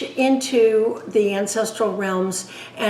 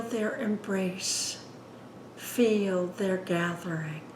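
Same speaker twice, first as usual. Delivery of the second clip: unhurried, hushed guidance, phrase by phrase; guided meditation; 6 dB quieter than usual. Acted. Leads guided meditation after plain introduction.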